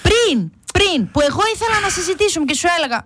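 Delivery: high, hurried reproach; acted outburst, insisting at a high pitch; exasperated. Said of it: "Θα φωνάζω". (αγανακτισμένη κατηγορία, ψηλά και γρήγορα)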